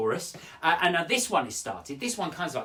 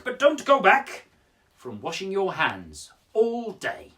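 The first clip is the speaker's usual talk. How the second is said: slow, grave public information voice; very serious voice; 4 semitones higher, 5 dB louder than usual. Pretend. Presenter reading Amy's poem as a public information film, very serious. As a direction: announce it like a solemn government film. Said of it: "in that very serious voice".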